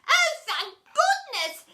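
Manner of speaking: very high, loud, slow relief; exclaiming. A narrator's reading. Boy freed from cupboard after two days, relieved exclamation. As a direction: cry out with huge relief. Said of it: "exclaimed Roland".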